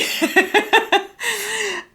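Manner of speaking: squealing laugh at herself; high embarrassed laugh. Real, not acted. Laughs after her own shout.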